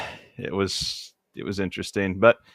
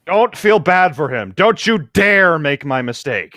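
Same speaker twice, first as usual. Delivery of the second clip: slow, a bit higher, hammed-up plea to the listeners; mock dramatic warning; 6 semitones higher, slower than usual. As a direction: warn them gravely, tongue in cheek.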